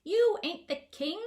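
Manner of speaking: high, slow, loud little voice of protest; small piping gnome voice. A narrator's reading. Hidden gnome objecting to the self-made king, high piping voice. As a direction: pipe up from behind the rosebush. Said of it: "piped up a small voice".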